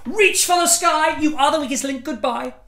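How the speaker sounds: high, loud game-show catchphrases; quiz host shout. Pretend. Poet shouting a quiz host's catchphrases in a rush.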